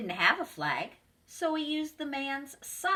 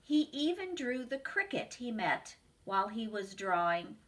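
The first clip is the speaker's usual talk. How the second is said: ordinary delivery, nothing marked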